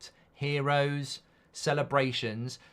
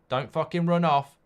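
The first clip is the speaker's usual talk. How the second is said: louder, higher mock shout; a raised comic yell at a dog; 5 semitones higher, 5 dB louder than usual. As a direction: bark at the dog to stay, half in jest. Yelling at fleeing dog.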